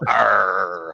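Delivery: low growled pirate arr. Acted. Pirate growl closing joke.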